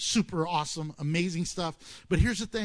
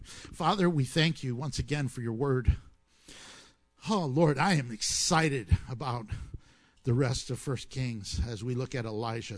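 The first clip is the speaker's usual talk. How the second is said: closing prayer; 4 semitones lower than usual. Acted. Pastor closes in prayer.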